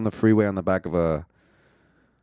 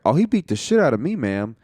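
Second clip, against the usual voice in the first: mock backpedal; 6 semitones higher, 4 dB louder than usual. Retelling a quick backpedalling lie.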